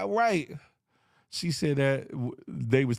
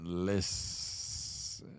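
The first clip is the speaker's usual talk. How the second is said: whispered listen; 10 semitones lower, 8 dB quieter than usual. Real, not acted. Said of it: "fading out".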